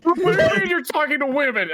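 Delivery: high, loud incredulous squawk; younger self voice. Retold or imitated. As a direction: play your clueless college self. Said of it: "college me would have been like".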